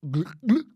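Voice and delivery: short imitated gut gulps; stomach gurgle. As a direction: make a bubbling tummy noise.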